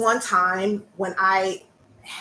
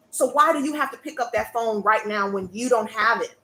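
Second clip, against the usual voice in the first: ordinary talk, nothing marked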